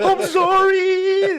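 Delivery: very high, loud, drawn-out fake wail; mock crying. Imitating girlfriends who cry mid-fight, mock crying. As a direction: wail it like a hammed-up sobbing apology. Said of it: "They start crying".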